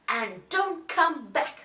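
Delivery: slow, loud warning; very cross. A narrator's reading. Bears send Goldilocks away, cross and slow. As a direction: punch out each word crossly. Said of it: "And they're very cross".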